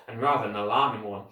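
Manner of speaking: ordinary delivery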